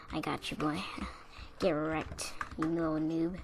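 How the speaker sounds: low, soft mock threat; quiet menace. Bawling boy smiling at his rival, softly menacing. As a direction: taunt him softly through a menacing smile. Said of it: "the death smile".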